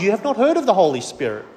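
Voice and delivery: ordinary delivery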